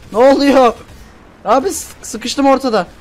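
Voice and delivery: stuck in the open, frantic; panicked. Real, not acted. Gamer stuck between enemies cries out in alarm. Stuck in the middle under fire.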